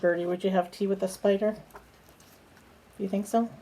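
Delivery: ordinary delivery, nothing marked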